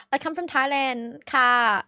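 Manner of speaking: mock Thai accent with a polite ending; put-on Thai accent. Shown as a demonstration. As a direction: try out a Thai-English accent. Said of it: "I should have just switched my accent".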